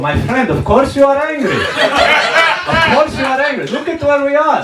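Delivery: high, excitable Israeli caricature; imitating an Israeli local. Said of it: "In Israel, if you get mad".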